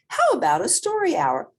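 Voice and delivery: high, loud, quick offer; bubbly teacher, high and brisk; cheery teacher. A narrator's reading.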